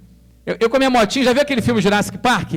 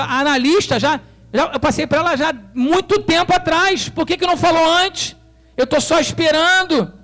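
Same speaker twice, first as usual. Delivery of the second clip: higher, louder indignant complaint; exasperated protest; 8 semitones higher, 4 dB louder than usual. Retold or imitated. To the realtor who withheld the keys, exasperated. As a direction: push back, fed up with waiting.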